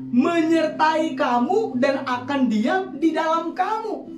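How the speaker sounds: usual speaking voice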